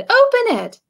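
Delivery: high, eager urging; excited animals. A narrator's reading. Animals excitedly urge George to open the present. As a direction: coax him to open it. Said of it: "they said".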